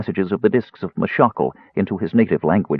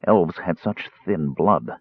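ordinary delivery, nothing marked

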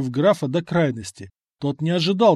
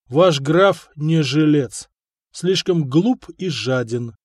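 slow grave warning; 4 dB louder, slower than usual. Acted. Самослав тихо предупреждает купца.

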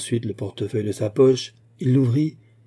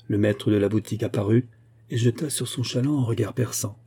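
plain reading voice

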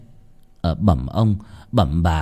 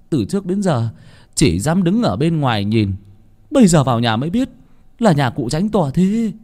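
high, gaping wonder; awed villager; 7 semitones higher than usual. A narrator's reading. Laborers marveling at the landlord's big house, high and amazed.

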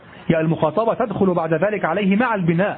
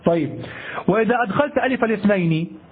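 ordinary talk, nothing marked

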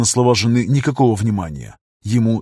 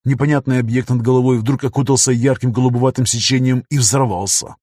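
ordinary delivery

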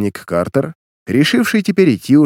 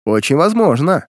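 mildly gloating, pitched higher; gloating smirk; 5 semitones higher, 4 dB louder than usual. A narrator's reading. Gang leader answers the vexed detective, mildly gloating. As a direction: savour it with a raised, smug lilt. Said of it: "злорадно ухмыляясь".

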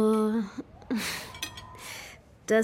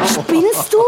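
loud, high angry outburst; outraged shout; 7 semitones higher, 10 dB louder than usual. Acted. Woman soaked by instructor's shaken bottle, shouting angrily. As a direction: yell at him for spraying you.

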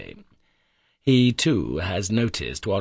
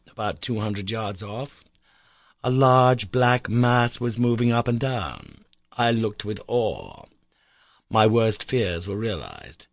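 ordinary delivery

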